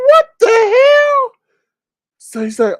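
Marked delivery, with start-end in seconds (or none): 0.1-1.3 very high, slow, louder cry; hammed-up shocked squeal; shocked shriek. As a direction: screech it in disbelief. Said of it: "I'm like".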